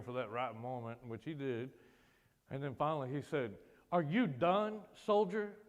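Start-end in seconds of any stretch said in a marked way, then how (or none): 3.9-5.6 higher, firm infantryman's demand; father's military challenge. Retold or imitated. Infantryman father confronting his child in hospital, stern challenge. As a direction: challenge the soldier like a commanding officer.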